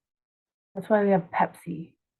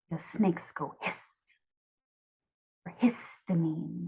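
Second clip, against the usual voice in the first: snake hiss; 8 dB quieter than usual. Shown as a demonstration.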